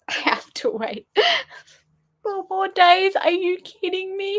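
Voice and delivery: high, laughing half-sob of disbelief; laughing wail. Real, not acted. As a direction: wail through laughter at the wait. Cliffhanger wait, laughing cry.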